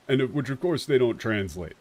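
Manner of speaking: ordinary talk, nothing marked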